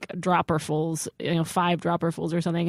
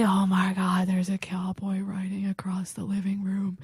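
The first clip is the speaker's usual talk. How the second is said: whisper; 6 dB quieter than usual. Retold or imitated. Stoned friend's awed hush.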